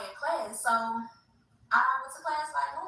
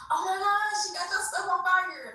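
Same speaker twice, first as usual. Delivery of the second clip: high, excited mock scream; retold scream; 9 semitones higher than usual. Neighbor screaming at the burning stuff, high excited mock scream. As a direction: shriek it out like you just saw the fire. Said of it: "I heard her scream".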